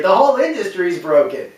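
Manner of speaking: ordinary talk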